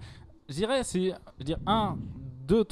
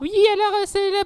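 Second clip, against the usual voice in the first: very high squeaky voice; highest voice; faster than usual. Pretend. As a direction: push the voice as high as it goes. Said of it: "votre voix la plus aiguë".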